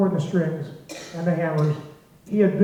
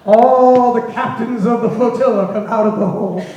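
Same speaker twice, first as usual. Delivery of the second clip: louder and higher, laughing through the words; can't hold back the laugh at the punchline; 5 semitones higher, 6 dB louder than usual. Real, not acted. Punchline of the smoke story.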